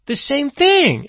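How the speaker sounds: normal talking voice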